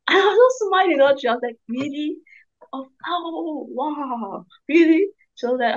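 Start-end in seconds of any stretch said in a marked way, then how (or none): 1.7-5.1 high, tearful, breathless wonder; amazed disbelief. Retold or imitated. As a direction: gasp it out as if overwhelmed by the answer. Retelling her own amazed reaction.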